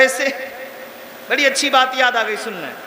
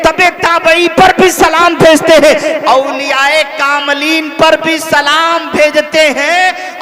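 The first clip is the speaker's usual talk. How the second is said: loud, driving sermon delivery; full-force preaching in rhythmic, repeated waves; impassioned preaching; 6 dB louder than usual. Real, not acted. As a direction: fire off each line like a rallying preacher, stretching the last ones.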